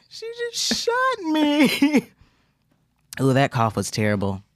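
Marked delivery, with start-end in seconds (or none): 0.1-2.1 very high, very slow, giggling; laughing replay of the quote. Retold or imitated. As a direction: stretch the line out high through laughter.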